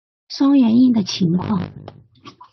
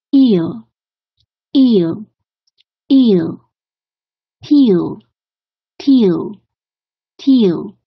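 each word said clearly, louder, with a falling finish; pronunciation drill; 4 dB louder, much slower than usual. Shown as a demonstration. Teacher drilling ear and kneel words for students, loud and clear. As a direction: model each word for the class to repeat. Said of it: "repeat-after-me drill".

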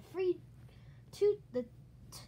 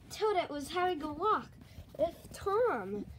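usual speaking voice

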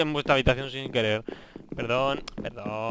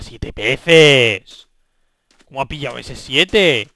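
loud, incredulous outburst; outraged disbelief; 13 dB louder, much slower than usual. Real, not acted. Gamer amazed at companion's seven fish, loud disbelief. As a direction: exclaim it loudly in disbelief.

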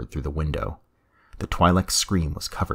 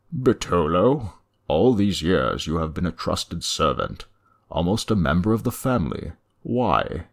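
appalled; 2 semitones higher, 4 dB louder than usual. Acted.

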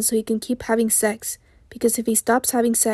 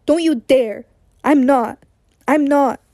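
raised, insistent angry protest; yelling; 3 semitones higher, 4 dB louder, slower than usual. A narrator's reading. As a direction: protest hotly, each denial more forceful. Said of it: "he yells".

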